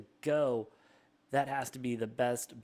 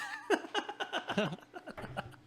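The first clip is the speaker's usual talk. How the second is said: high laugh. Real, not acted. Laughs at own flub.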